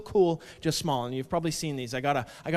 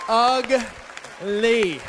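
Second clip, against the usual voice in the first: sharp shouted ugly; loud; 5 semitones higher, 3 dB louder than usual. Acted. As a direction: bark out the word. Punchline shouted word.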